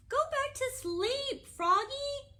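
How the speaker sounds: high, loud motherly call; high-pitched character mother; frog mother. A narrator's reading. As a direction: voice a storybook mom sending her child to bed. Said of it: "said his mother".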